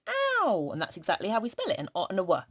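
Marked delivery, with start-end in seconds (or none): 0.1-0.7 high pretend ow as if hurt; mock ow of pain. Shown as a demonstration. Teacher acting out hurting herself for the children, high mock yelp. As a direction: yelp a playful ow. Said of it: "you say".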